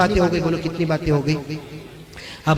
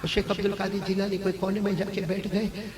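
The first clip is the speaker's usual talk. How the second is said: ordinary talk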